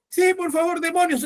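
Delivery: high simpering polite plea; mock pleading. Preacher imitates a person politely begging the demon, high mock plea.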